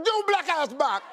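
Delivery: quick mock patois; rapid mock patois line; said fast. Shown as a demonstration. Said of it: "you just say it real fast".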